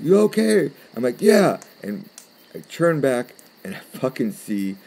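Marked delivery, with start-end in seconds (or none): 0.0-0.7 high, loud shouted question; imitated roommate yelling. Roommate checks on his friend in the woods, imitated yell. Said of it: "he starts screaming my name". 1.2-1.6 high, loud call back; yelled reply. Retold or imitated. Camper answers his roommate across the woods, mock yell.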